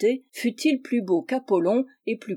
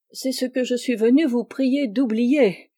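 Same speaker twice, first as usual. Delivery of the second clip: ordinary delivery, nothing marked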